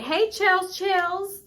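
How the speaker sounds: high-pitched mock greeting; Phony. Phony greeting her friend by nickname, high put-on voice. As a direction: call it out way up high. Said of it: "Phony was like".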